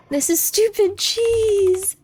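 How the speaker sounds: high, very slow goofy voice; odd put-on voice, drawn out; silly voice. Real, not acted. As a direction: stretch it out in a weird cartoonish voice. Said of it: "I don't know why I said that like that".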